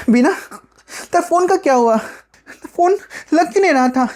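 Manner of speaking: put-on very high, incredulous boy; astonished questioning. Ronny finds Bina in the shop, astonished high questioning. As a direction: ask in high-pitched disbelief.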